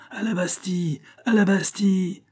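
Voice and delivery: high-pitched rallying cry; crowd shouting. A narrator's reading. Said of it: "s'agitait autour de lui en criant". (cri de ralliement de la foule)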